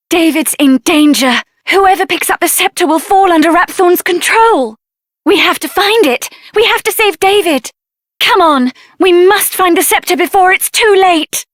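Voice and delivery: ordinary talk, nothing marked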